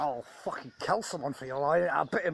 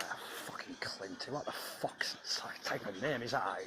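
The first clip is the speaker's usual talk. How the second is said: whispered scoff; 3 semitones lower, 7 dB quieter than usual. Real, not acted. Mocking the name.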